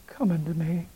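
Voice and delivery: unvoiced whisper of Come unto me; whispered call. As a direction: breathe the words like a call. Prayer, quoting God's call.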